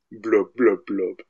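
wet gurgling blub noises; gurgling fish man croak. Retold or imitated. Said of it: "another voice that just goes".

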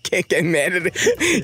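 slow, drawn-out teasing chant; mock mantra. Father parroting his parenting rule, mock mantra. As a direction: stretch it out through a laugh.

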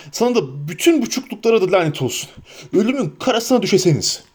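high, fast torrent of curses; a madman hunting and cursing; raving Boromir. A narrator's reading. Boromir cursing the invisible Frodo and all halflings, raving fast.

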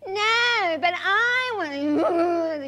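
put-on high, drawn-out whining child; whiny child. Mother imitating her quarrelling kids, whiny child voice. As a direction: whine it like a sulky kid.

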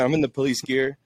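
usual speaking voice

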